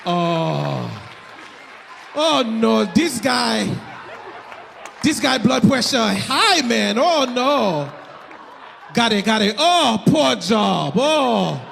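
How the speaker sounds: mock Haitian accent, lamenting commentary. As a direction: fret aloud in an imitated Haitian accent. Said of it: "I can do the accent".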